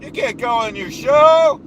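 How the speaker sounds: blustering self-important boss voice; pompous ranting persona. Acted. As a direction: puff up like a bragging strongman. Holds the Duke persona rant.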